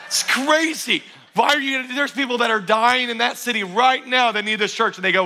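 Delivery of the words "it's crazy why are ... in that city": incredulous laughing outburst. Real, not acted. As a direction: laugh it off in disbelief, then call out. Pastor reacting to five-year plan.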